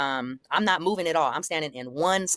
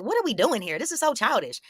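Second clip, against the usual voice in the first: higher-pitched incredulous protest; retold exasperation; 6 semitones higher than usual. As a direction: act out being fed up with childishness. Retold exasperation at petty squabble.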